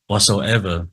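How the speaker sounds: ordinary delivery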